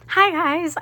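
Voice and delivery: hi guys, way up and loud; bright greeting. Real, not acted. Vlogger greeting her channel, high and loud. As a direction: greet the viewers with a burst of energy.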